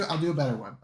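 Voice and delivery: normal talking voice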